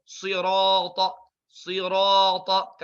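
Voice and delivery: Sirata slow with heavy sad, ra and ta; heavy letters; thick sirata. Shown as a demonstration. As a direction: darken every letter. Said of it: "semuanya ikut tebal".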